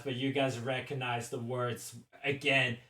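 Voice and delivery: normal talking voice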